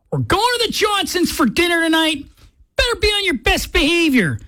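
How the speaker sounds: a hint of a stern parent before dinner out; parent's warning. Parent warning the kids before dinner, lightly stern. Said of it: "your parents said".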